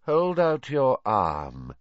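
slow, lazy command. A narrator's reading. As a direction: drawl it lazily. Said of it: "said Voldemort lazily".

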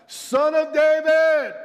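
long, high, loud cry for help; shouting. Acted. Blind beggar calling to Jesus, shouting. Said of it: "he began to shout".

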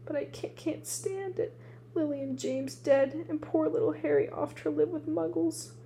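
lightly performed tearful lament; sobbing. A narrator's reading. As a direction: let the grief break through. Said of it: "sobbed Hagrid".

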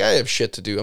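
ordinary talk

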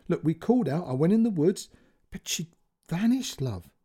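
a touch of a breaking voice, pushing on; father holding back tears, lightly voiced; voice near breaking. A narrator's reading. Father on phone tells daughter child vanished, voice nearly breaking. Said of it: "his voice was near to breaking".

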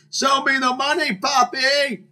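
very high celebratory holler; bingo winner's cry. Retold or imitated. Bingo winner stands before the room, hollered catchphrase. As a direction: belt it out like a catchphrase. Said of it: "tell them to stand up and go".

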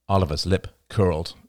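plain reading voice

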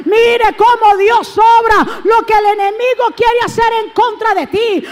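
predicando a plena voz, más alto y fuerte; exhortación encendida a la congregación; predicación. Acted. Preaching at full force. (predicador gritando con fervor)

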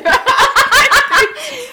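high, loud burst of laughter. Real, not acted. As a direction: crack up helplessly. Cracking up mid-story.

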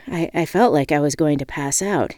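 ordinary delivery

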